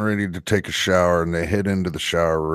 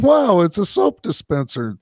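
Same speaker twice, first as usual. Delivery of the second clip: laughing; 10 semitones higher than usual. Retold or imitated. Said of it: "And they're laughing, going".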